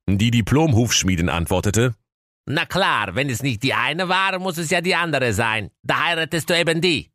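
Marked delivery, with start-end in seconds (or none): 2.4-7.1 high-pitched brisk mother figure; matter-of-fact farrier woman. A narrator's reading. Farrier offering her other daughter, high offhand voice. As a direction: settle the match in a high voice.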